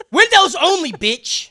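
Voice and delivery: high, very loud comic command; shouted mock order. Guys refusing her the door, shouted mock order. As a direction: bellow it as a punchline. Acted rule shouted as punchline.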